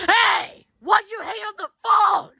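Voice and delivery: loud, high angry yell; angry shout. Acted. Yelling at Talking Ben for hanging up on him, loud and angry.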